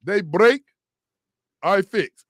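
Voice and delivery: loud, punchy ad slogan; a radio host loudly imitating a car shop's slogan; commercial voice. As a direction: belt it out like a TV commercial tagline. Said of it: "his commercial say".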